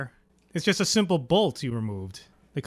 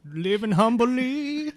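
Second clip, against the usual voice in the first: slow, higher humble southern drawl; southern accent; 7 semitones higher, much slower than usual. Pretend. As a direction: stretch it out like a meek Southern dragon. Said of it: "Why are the dragons southern and talking?".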